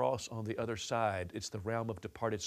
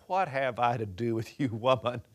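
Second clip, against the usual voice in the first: warmer and louder, with a smile in the voice; playful wink; 5 dB louder than usual. Shown as a demonstration. Jesus teasing his mother at the wedding, smiling. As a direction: tease her gently, like a wink. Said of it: "he winked and smiled at her".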